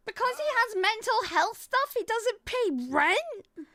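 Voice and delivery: incredulous. Real, not acted. Streamer doubting the rent excuse, incredulous. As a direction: stretch it out in disbelief.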